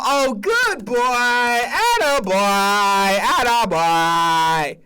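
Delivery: put-on slow, stretched atta boy; praising the dog in a mock chat; dog praise. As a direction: coo at the dog, drawn out. Praising dog in colleague scene.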